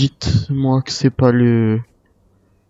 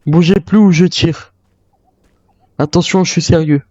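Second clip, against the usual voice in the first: raised voice, deadly serious; angry threat; 3 semitones higher than usual. Acted. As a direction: insist you'll really shoot. Armed standoff, threatening.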